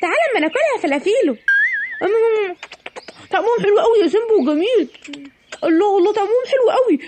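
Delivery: put-on high, quick, giggly kid voice; two sneaky kids whisper-plot over stolen candy, high and giggly; greedy little thieves. Sneaky kids find unguarded sweets.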